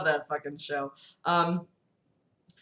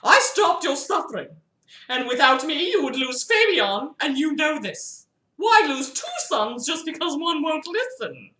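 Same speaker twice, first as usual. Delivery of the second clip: higher pleading put-on voice; high character voice; 9 semitones higher than usual. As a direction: argue your case up high.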